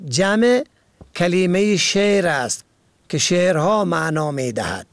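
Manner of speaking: ordinary talk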